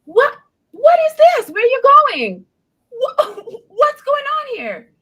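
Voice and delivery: loud, high-pitched retold shock; shocked outcry. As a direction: cry out in alarmed disbelief, pitch way up. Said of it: "So I was shocked".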